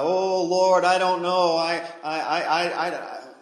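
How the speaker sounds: high, loud nervous stammer with a laugh; stammering Moses. Acted. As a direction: fumble every word in a panic. Moses stammers before God.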